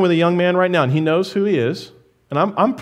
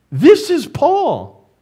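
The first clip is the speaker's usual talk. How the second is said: slow, much higher emphatic This; stress on Paul; 9 semitones higher, 3 dB louder, much slower than usual. Acted. Preacher stresses to the church who wrote it, slow and high. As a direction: lean hard on This, drawn out. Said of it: "emphatic point about Paul".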